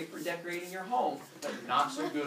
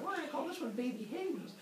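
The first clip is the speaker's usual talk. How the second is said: high put-on feminine voice; name the baby in a high-pitched coo; mock-motherly high voice; proud mother; 11 semitones higher, 4 dB quieter than usual.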